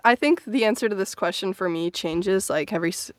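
ordinary talk, nothing marked

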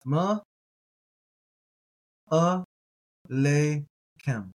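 a-le-kem drawn out, each syllable apart; very slow teaching read. Shown as a demonstration. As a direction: spell it out slowly, a, le, kem. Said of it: "All right, we have ma".

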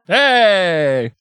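high, drawn-out "Hey!" in mock accent; playful accented holler; put-on accent hey. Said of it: "I like going, "Hey!" after you do it".

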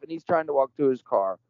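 ordinary talk

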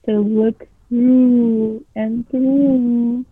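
drawn-out monster answer, very slow; slow creature voice. A narrator's reading. Creature in the chimney answering the boy, slow. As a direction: savor each word like a lurking beast. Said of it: "the creature answered".

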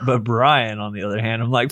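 ordinary talk

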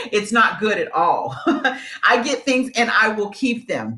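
saying it through real laughter, slowly; laughing confession. Real, not acted. Admitting to viewers she hoards things, laughing. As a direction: let the laugh spill into the words. Admitting she's a pack rat.

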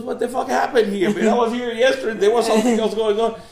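cracking up while acting shocked; laughing outrage. Politician shocked at hell's change.

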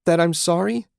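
high, loud rising question; yelling. A narrator's reading. Julie yelling at Susan about being sorry, high. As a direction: fling it back as a yelled question. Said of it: "Julie yelled".

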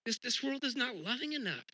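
high, pointed dismissal; dissatisfied. Retold or imitated. Looking at the world, scoffing not good enough. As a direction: scoff at how things are.

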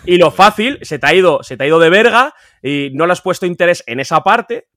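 mucho más alto y enfadado, subiendo el tono; suéltalo con rabia, indignado; indignación. Real, not acted. Indignado por la parte mal hecha. (indignado con la falsificación mal hecha, subiendo la voz)

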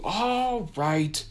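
high, loud yell. A narrator's reading. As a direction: holler it back. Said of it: "I yelled".

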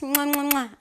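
three quick smacking kisses; air kisses. Real, not acted. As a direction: blow a string of kisses. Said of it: "More kisses".

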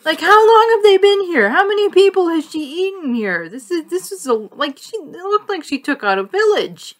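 high, loud disbelieving questions; incredulous outburst. Real, not acted. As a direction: let the pitch soar as the horror piles up. Rhetorical questions in mock disbelief.

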